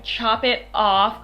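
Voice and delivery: normal talking voice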